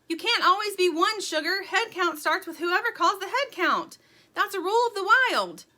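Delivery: loud, high squabbling retort; arguing shout. A narrator's reading. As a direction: shout the rules at a rival. Shouted squabble over head count.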